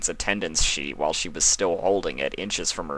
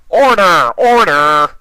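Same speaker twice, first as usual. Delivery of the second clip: much higher, much louder mock courtroom call; judge shouting order; 11 semitones higher, 13 dB louder, slower than usual. Discord dressed as a judge silencing the table, a hammed-up shout. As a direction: bang the gavel and bellow.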